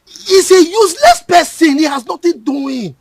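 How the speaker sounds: scornful. Acted. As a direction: spit out the insult slowly. Uncle disparaging the boy.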